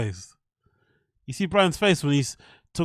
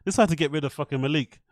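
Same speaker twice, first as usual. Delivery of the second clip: ordinary talk, nothing marked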